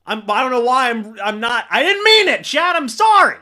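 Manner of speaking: blurting a flustered sorry to chat; too excited. Real, not acted. Streamer apologising to chat, flustered excitement. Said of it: "I'm too excited".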